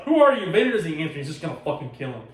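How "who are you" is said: high, loud retold panic; mock scared. Inquisitor suddenly facing Vader's attack, acted-out scared outburst. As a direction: yelp it as if suddenly scared of him. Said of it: "now all of a sudden he's scared".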